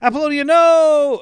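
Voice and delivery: high, very loud mock scream; Jennifer Beals. Club owner screaming as the bomb is found, dramatic shriek. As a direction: scream the name in hammed-up horror. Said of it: "Jennifer Beals screams".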